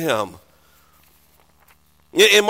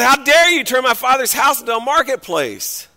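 higher, indignant acted outburst; angry rebuke; 4 semitones higher, 3 dB louder than usual. Preacher voicing Jesus to temple traders, angry rebuke. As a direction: rebuke them with outrage. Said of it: "He got angry".

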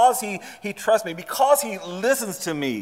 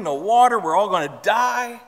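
higher-pitched complaining crowd; mock grumbling; 4 semitones higher, 4 dB louder than usual. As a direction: act out exaggerated doom-laden griping. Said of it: "they're grumbling".

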